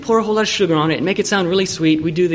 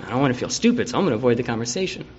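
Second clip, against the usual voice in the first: softly, muttering excuses to oneself; under the breath, avoiding the issue; sulky mutter; 5 semitones lower, 6 dB quieter, much faster than usual. Retold or imitated. Immature person muttering excuses.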